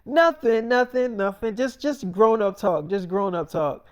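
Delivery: flustered brush-off. Retold or imitated. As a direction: laugh it off nervously to the kid. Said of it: "She's like".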